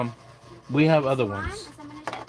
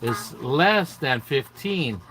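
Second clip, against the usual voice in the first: slow teaching voice; 3 semitones higher, much slower than usual. Shown as a demonstration. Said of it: "teaching a child slowly".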